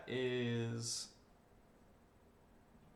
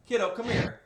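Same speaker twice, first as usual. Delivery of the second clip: calling out; 12 semitones higher, 8 dB louder than usual. Real, not acted. Calling child from afar.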